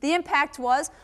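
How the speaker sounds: normal talking voice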